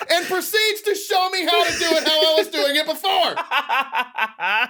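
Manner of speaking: high, slow laughing outburst; cracking up while yelling it; laughing shout. Real, not acted. Laughing outrage at dad.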